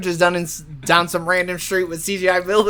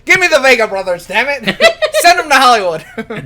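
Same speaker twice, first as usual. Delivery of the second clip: excited; 5 semitones higher, 7 dB louder than usual. Pretend. Mock eager studio demand.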